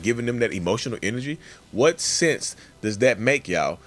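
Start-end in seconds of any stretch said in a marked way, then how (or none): none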